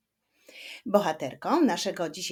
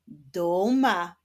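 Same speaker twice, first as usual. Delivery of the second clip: "Duma" in two sustained beats; split into syllables; 3 dB louder than usual. Shown as a demonstration. Repeats rebus answer in syllables.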